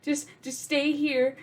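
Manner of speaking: high, mock-soothing big sister voice; calm him down sweetly while faking it; imitating a child reassuring her crying brother. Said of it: "And I'm just like".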